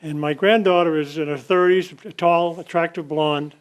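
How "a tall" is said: ordinary talk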